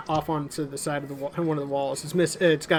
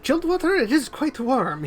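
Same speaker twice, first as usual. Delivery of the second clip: higher, hospitable nobleman; genial lord; 9 semitones higher than usual. Acted. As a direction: offer drinks with lordly charm. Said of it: "he says".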